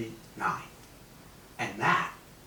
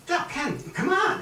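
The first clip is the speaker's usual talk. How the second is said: pleading. Retold or imitated. Pleading with key punch manager.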